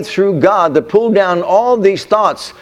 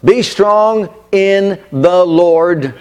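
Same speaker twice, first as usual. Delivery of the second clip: very slow, weighty declaration; slow emphatic; much slower than usual. Shown as a demonstration. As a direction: land each word slowly. Corrects wrong versions of verse.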